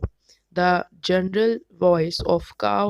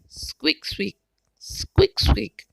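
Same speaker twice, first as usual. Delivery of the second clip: high chirpy squeaks, one whispered; squirrel squeak; 3 semitones higher, slower than usual. Retold or imitated. Presenter imitating a squirrel for listeners, high squeaks. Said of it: "Squirrel says".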